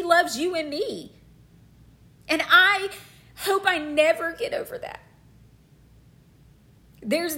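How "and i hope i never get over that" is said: choking up mid-sentence; crying. Real, not acted. Preacher to her church about the blood of Jesus, crying. Preacher overcome telling of salvation.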